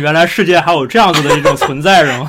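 loud teasing laugh through it. Real, not acted. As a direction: tease her while laughing.

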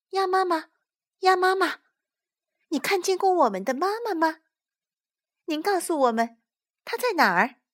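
put-on high, eager child voice; high-pitched little creature asking; little tadpoles. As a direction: call and ask like small tadpoles. Tadpoles ask mother duck.

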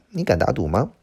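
ordinary talk

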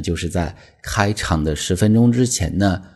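ordinary delivery

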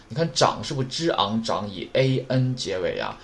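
ordinary talk

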